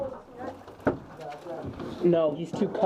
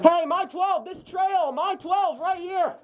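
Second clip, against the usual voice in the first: shouted callout; 9 semitones higher, much slower than usual. Real, not acted. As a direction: point out the enemy by yelling. Calling enemy to team.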